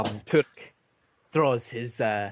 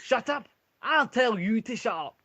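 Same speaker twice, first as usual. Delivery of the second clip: high, loud indignant yell; dwarf Ulfric yelling back at Turek; shouting back; 12 semitones higher, 6 dB louder than usual. Acted. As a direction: yell it right back at him.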